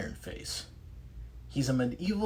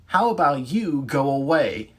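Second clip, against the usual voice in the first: loud defiant British-free taunt; defiant retort; 4 semitones higher, 9 dB louder than usual. Acted. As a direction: tell him off loudly. Liza confronting monster.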